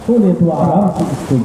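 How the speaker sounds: restrained poetic delivery; verse recitation. Acted. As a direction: recite it evenly, without soaring. Verse repeated lower.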